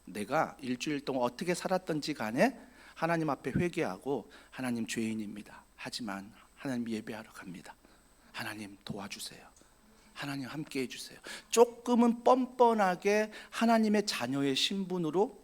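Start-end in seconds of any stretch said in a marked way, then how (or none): 4.5-11.2 humble prayer. Retold or imitated. Quiet humble prayer voiced.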